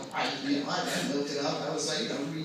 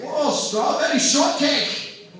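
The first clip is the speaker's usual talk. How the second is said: loud, higher-pitched mock Scottish accent; Sean Connery; 6 semitones higher, 7 dB louder than usual. Comic as the star ogling a toy, exaggerated Scottish accent. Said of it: "He's like".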